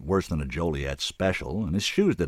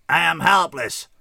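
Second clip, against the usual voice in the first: slow, much higher, emotionless alien; dispassionate; 10 semitones higher, 7 dB louder, slower than usual. A narrator's reading. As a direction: state helplessness without feeling. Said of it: "said dispassionately".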